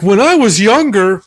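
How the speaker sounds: high-pitched mock child voice; reminiscing kid. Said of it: "heard a kid go".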